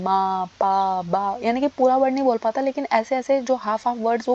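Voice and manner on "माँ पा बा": slow, drawn-out single syllables, low; baby babbling demo. Shown as a demonstration. Teacher shows students how a baby of four to six months babbles, drawn-out demo babbling. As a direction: lightly imitate babbling in stretched, separate syllables. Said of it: "वो babbling करने लग जाता है".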